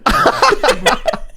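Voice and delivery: words squeezed out through laughter; can barely talk for laughing; laughing hard. Real, not acted. Laughing fit while telling story.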